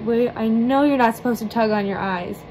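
normal talking voice

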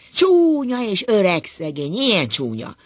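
loud high outburst that drops; shouting. A narrator's reading. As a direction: cry out the first word, long and loud. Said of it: "Kiáltott Ákos kijelegve". (felindult kiáltás)

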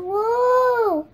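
high, loud drawn-out whoa; playful whoa. Pretend. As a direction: cry out as if rolling down. Toy puppy rolling down.

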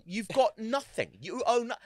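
high, loud, outraged challenge; retold indignation. Re-enacting his row with strangers, indignant. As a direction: confront them, re-enacting the outrage.

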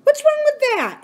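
put-on sharp, high, indignant girl's demand. A narrator's reading. Girl demanding of the laughing boy, indignant. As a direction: snap the question up high. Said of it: "she demanded".

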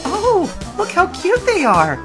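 very high, cooing delight; gushing. A narrator's reading. Misty spots the little Diglett, gushing. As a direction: gush over something adorable, way up high. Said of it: "Misty gushed".

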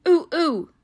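high, loud playful monkey ooh-ooh; child hooting at the monkeys, high and loud; monkey hoots. Retold or imitated. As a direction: hoot like a monkey at the zoo. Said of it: "I said to the monkeys".

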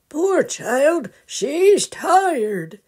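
tender grandpa bear. Acted. Grandpa sees sleeping Sally.

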